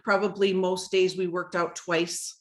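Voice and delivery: ordinary talk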